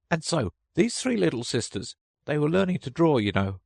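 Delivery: a hint of a high little Dormouse telling a tale; lightly voiced Dormouse. A narrator's reading. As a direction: tell the story as a small sleepy creature.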